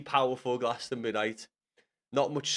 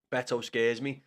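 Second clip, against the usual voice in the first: normal talking voice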